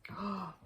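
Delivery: soft, drawn gasp of suspense; story-time gasp. Pretend. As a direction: gasp for the listening children. Reader reacts to story suspense.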